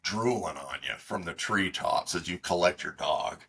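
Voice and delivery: normal talking voice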